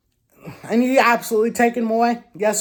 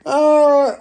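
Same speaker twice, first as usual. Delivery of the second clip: laughing; 5 semitones higher, 7 dB louder than usual. Real, not acted. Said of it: "I'm just laughing".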